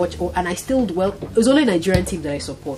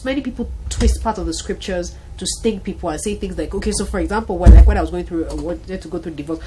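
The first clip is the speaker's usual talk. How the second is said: usual speaking voice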